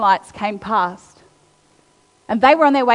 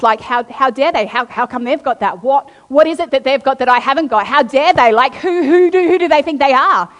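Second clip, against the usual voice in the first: rising, louder, how dare they; indignant outburst; 2 semitones higher, 6 dB louder than usual. Pretend. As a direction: build the jealous indignation to a loud peak. Voicing jealous hearts' indignation.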